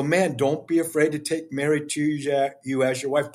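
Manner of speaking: ordinary talk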